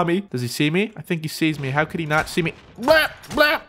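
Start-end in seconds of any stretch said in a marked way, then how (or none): 2.8-3.6 high-pitched cartoon "blah" noises; spluttered blah. Gamer getting hit, spluttered blahs. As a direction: blurt out two silly yelps.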